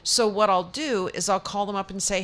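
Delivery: ordinary delivery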